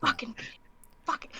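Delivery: soft, muttered swearing; mumbling curses. Acted. Framed prisoner mumbling curses under her breath. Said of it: "starts mumbling again".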